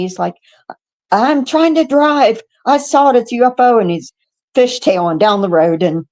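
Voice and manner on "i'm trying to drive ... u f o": mad. Retold or imitated. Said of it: "he's so mad at me".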